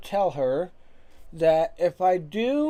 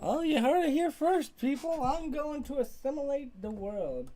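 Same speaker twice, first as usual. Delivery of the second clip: excited; 7 semitones higher, 3 dB quieter than usual. Pretend. Mock hype after Sora's boast.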